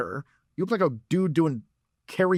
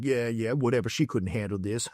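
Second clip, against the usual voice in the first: dismissive bravado; 3 semitones lower, 4 dB quieter than usual. Acted. Catcaller walking away with bravado.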